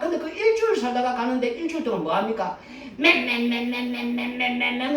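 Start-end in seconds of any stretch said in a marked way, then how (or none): 3.0-5.0 buzzing 맨맨맨 like a cicada, steady and droning; playful cicada buzz; cicada chirp imitation. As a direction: mimic a cicada's endless call. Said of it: "얼마나 울어댑니까?".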